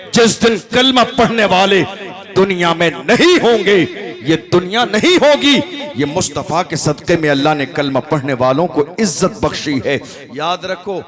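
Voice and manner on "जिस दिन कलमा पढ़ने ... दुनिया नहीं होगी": louder, higher preaching peak; fervent climax. Real, not acted. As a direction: drive the point home at full force. Climax of sermon, crowd roused.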